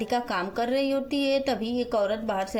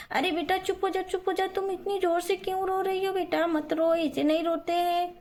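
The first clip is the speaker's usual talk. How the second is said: put-on higher-pitched storybook woman hushing a child; Radhika soothing, fretting; 6 semitones higher than usual. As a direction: fret over a crying little girl. (बच्ची को चुप कराती परेशान राधिका)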